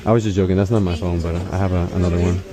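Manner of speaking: ordinary talk, nothing marked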